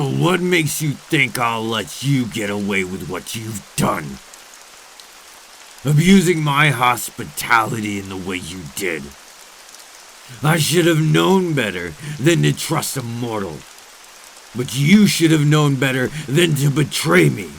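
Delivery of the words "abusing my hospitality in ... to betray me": rising to a loud, higher furious outburst; furious vampire. Acted. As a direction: let the betrayal boil over. Vampire confronts the betraying guest.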